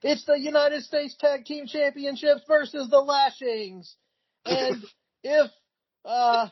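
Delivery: high-pitched mock ring announcer, overwhelmed; overwhelmed Gary Michael Cappetta. Ring announcer swamped by stipulations, high-pitched overwhelmed impression. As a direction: put on a high announcer voice, faltering into "uh". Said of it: "is so overwhelmed by all these stipulations".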